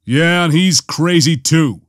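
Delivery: slow, mildly irritated growl. A narrator's reading. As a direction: grumble it slowly, annoyed. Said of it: "Han growled".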